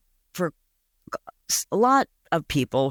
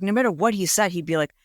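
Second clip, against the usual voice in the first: ordinary talk, nothing marked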